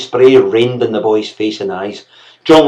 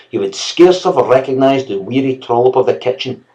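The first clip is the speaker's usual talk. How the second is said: plain reading voice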